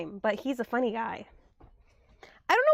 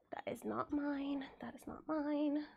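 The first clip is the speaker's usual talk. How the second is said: whisper; 10 dB quieter than usual. Real, not acted. Whispering while organizing on stream.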